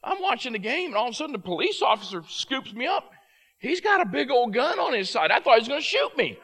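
put-on high, loud, fast little boy's voice; child's voice. Four-year-old son explains to dad why he cried, high and fast. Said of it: "He goes".

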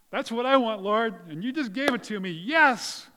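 loud, high-pitched burst of delight; elated thanks. Acted. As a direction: celebrate an answered prayer, building to a big yes. Acting out joy at yes answer.

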